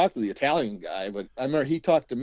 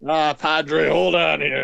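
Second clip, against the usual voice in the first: lightly voiced, louder stalling plea; mock stalling; 4 semitones higher, 7 dB louder, much slower than usual. Imitating himself stalling the visitor while on the bedpan. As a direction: beg for a moment, a bit louder. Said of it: "acting his reply to visitor".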